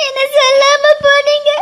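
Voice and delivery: hurt sister sobbing at her brother; tearful reproach. Acted. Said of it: "அழாதம்மா". (அண்ணனிடம் அழுதபடி குறை கூறும் தங்கை)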